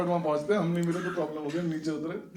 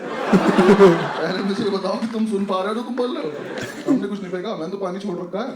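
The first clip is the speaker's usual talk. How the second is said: cheeky loud retort; 3 semitones higher, 8 dB louder than usual. Retold or imitated. Student talking back to the warden, loud mock-indignant retort. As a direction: talk back defiantly, playing innocent.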